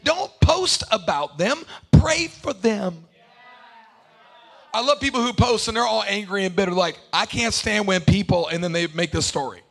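loud, driving sermon over music; loud sermon build over the organ; preaching at full force. Real, not acted. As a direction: preach it to the crowd with fire.

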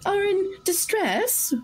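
gentle halfling coaxing. Acted. Halfling coaxing frightened NPC.

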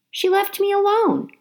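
usual speaking voice

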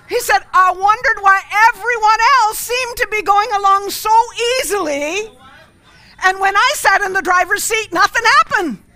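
high, loud, wide-eyed rant; excited clueless man. Acted. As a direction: blurt it out like a guileless simpleton. Clueless man explains in joke.

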